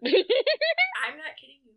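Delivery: laughing loudly through the words. Real, not acted. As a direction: burst out laughing while talking. Laughing while telling outrageous story.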